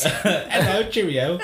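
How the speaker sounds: giggling through a mock London accent; a laughing, half-hearted London accent. Laughing while trying a London accent for friends. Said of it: "You talk like you're from London".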